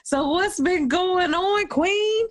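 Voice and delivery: high, slow, playful question; excited. Real, not acted. Host prompting guest playfully.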